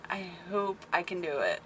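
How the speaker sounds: unsure hoping. Real, not acted. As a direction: say it quietly, hoping you can manage. Doubt, then self-correction.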